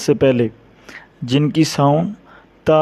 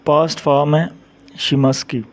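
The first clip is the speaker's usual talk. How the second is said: usual speaking voice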